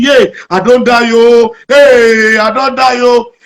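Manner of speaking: mock terrified shout at the lion; panicked yell. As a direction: yell like a man facing a lion. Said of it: "He kept shouting".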